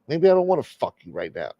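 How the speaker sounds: ordinary talk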